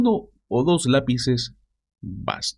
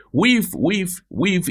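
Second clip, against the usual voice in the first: lightly mimicked, higher breathy whiffs; a light imitation of Trump's whiff, higher pitched; mocking imitation; 7 semitones higher, much slower than usual. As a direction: mimic the odd whiff noise, half mocking. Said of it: "Empieza a decir".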